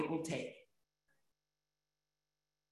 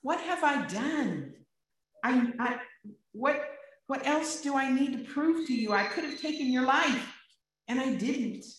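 David begging Saul, high and pressing; pleading; 6 semitones higher, 4 dB louder than usual. Acted. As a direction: press the question like a wronged friend. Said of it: "He's pleading with him".

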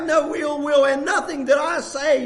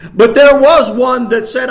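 suddenly booming out the line; loud call; 12 dB louder than usual. Real, not acted. Preacher booming to his congregation, loud. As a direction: boom it to the congregation.

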